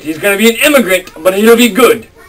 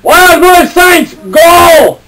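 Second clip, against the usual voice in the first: shouting; 7 semitones higher, much slower than usual. Acted. Cheering on the potion.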